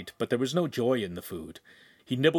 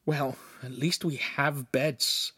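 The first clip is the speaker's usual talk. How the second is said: hissed; 3 semitones higher, slower than usual. A narrator's reading. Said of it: "Lincoln hissed".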